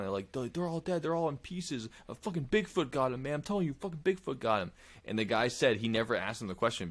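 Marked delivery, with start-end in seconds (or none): 0.3-4.7 high-pitched mock-frantic insisting; hammed-up panic, swearing it was Bigfoot; imitating a scared picker, pitched high; retold terror. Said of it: "they're screaming".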